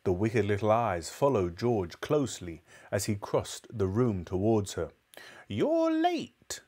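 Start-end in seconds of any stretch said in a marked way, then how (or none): 5.5-6.6 put-on high whispered snap of an old woman; snapped. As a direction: hiss it sharply like a crabby granny. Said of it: "she snapped".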